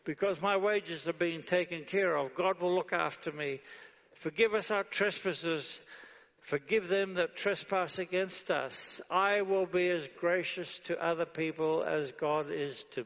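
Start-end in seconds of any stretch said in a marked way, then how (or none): 4.1-9.0 measured, emphatic prayer line; preacher reciting the prayer to the church; prayer recitation. Acted. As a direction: recite it with weight.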